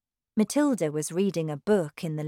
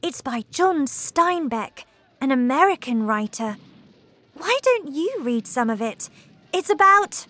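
put-on higher eager girl's voice; child Matilda; 7 semitones higher than usual. A narrator's reading. Matilda telling her father about her book, eager. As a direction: defend the book brightly.

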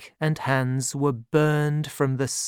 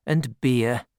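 plain reading voice

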